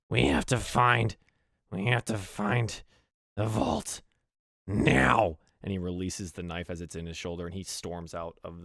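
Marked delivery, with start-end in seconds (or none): 0.1-5.4 high urgent goblin; goblin boss. Acted. Goblin boss ordering the lackey, higher. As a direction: press them to hurry. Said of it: "he goes".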